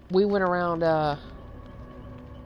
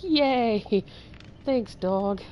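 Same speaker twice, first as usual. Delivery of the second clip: sarcastic; 6 semitones higher, much slower than usual. Retold or imitated. Ironic thanks after dog dies.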